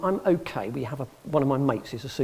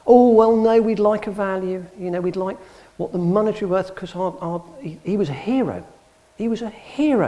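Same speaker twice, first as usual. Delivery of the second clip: louder, higher imitated indignation, insisting; indignant protest; 6 semitones higher, 6 dB louder than usual. Family insisting to the expert, imitated loud indignation. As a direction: protest that he was a hero.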